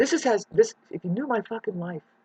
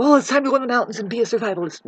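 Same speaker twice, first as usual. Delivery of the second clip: fast, mocking singsong; mock them quickly; mocking quote; 6 semitones higher, 6 dB louder, much faster than usual. Vlogger mocks latecomer survivalists, fast and sneering.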